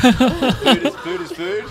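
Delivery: high, loud, cracking up on the words; laughing repeat. Retold or imitated. Teller repeats Ian's punchline, cracking up. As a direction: laugh the line out again.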